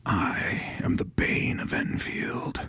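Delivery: slow, menacing whisper; villain's whisper. Shown as a demonstration. Game master demonstrating a villain down here, sinister whisper. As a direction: whisper it slowly like a lurking villain. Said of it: "he'll whisper".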